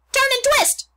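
very high, loud honk of words; honking goose. A narrator's reading. The goose directing Wilbur's escape, very high loud honk. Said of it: "honked the goose".

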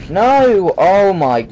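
sudden high yelp of alarm; panicking gamer. Real, not acted. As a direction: cry out as it goes wrong. Boss fight goes wrong.

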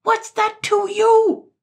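very high, loud, drawn-out angry shriek; piercing indignant yell. A narrator's reading. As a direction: shriek it in outrage. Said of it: "shrieked Sir Buzz".

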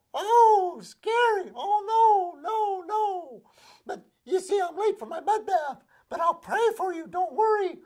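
very high, loud, fluttery pig voice; a vain pig too busy to care; breezy, insincere sympathy; airy pig. Acted. Pig brushes off mouse.

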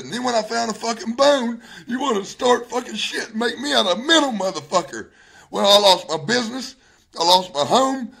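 high, loud, aggrieved outburst; distraught anger. Real, not acted. Husband listing all he lost over this, distraught anger.

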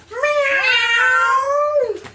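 long, high, loud yowling meow; loud cat meow. Acted. Narrator introduces Mister Twinklepaws, loud meow. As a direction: belt out a drawn-out cat's miaow.

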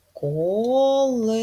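колеса said slowly, with the last syllable lifted; slow stressed demo. Shown as a demonstration. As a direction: lean on the -са in колеса. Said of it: "і ми чітко чуємо звук Е". (повільно, наголос на колеСА)

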